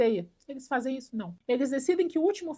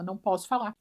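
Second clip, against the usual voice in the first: normal talking voice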